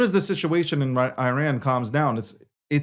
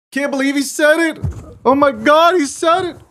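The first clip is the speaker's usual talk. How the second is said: much higher and louder, hammed-up outrage; mock shocked disbelief; 13 semitones higher, 7 dB louder than usual. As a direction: squeal it like you can't believe he said it. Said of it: "That, that took you".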